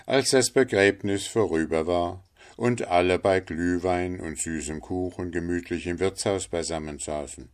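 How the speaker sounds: ordinary delivery, nothing marked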